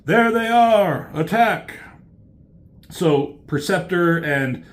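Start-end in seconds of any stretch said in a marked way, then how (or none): 0.0-1.8 high, raised call to attack; rallying shout in a retelling; acted battle cry. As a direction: call out the charge, drawn out.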